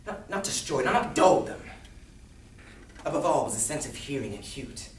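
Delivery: theatrical madman insisting on his sanity; unhinged storyteller, volatile and intense; nervous madman narrator. Acted. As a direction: swing from hushed confiding to wild outbursts. Performs mad narrator's monologue.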